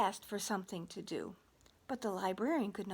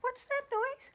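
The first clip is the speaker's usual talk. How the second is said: high puzzled question; playful high librarian voice. Acted. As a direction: ask it like a baffled librarian. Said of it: "and said".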